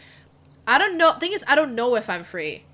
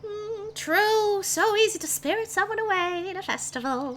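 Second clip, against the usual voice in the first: high, sly hag croon; 11 semitones higher than usual. Acted. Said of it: "the hag goes like".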